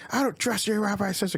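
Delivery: high-pitched imitation of a distrustful bride; mock angry retort. Bride's retort in acted quarrel.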